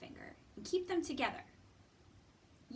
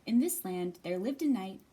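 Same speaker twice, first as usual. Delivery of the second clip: put-on louder storybook narration for children; story reading; 8 dB louder than usual. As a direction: tell it like a bedtime tale. Reads picture book aloud.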